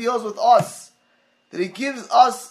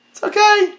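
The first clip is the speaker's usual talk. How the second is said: much higher, louder shrugging okay; lax parent brushing it off; 13 semitones higher, 6 dB louder than usual. Retold or imitated. Parent waving off his kid's talking in shul, mock carefree. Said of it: "and he said".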